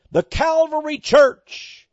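high, drawn-out pulpit shout; proclaiming shout. Real, not acted. Preacher naming the huge church he visited, proclaiming shout. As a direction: proclaim the name high, loud and stretched.

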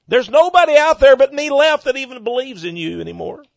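loud, high anguished complaint; despairing prophet. Retold or imitated. Elijah crying out to the Lord, loud high anguish. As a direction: cry out like Elijah to God. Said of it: "he said".

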